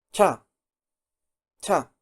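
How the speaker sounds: cha said with a sharp, explosive burst of air. Shown as a demonstration. Teacher demonstrating the Korean cha sound, explosive. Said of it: "Así, explosiva".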